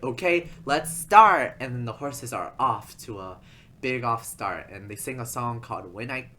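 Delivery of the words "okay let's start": loud, high announcer starting a race; Austin. Retold or imitated. Announcer starts the horse race, loud hammed-up announcer call. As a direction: draw it out as a big starting call. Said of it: "Austin is like".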